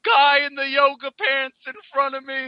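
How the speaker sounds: Mankind promo voice, high and slow; wrestling promo. Acted. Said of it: "Mankind impression".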